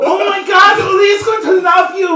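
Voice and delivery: very high, loud breathless squeal; hyperventilating jubilation. Acted. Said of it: "hyperventilating jubilation of Makani".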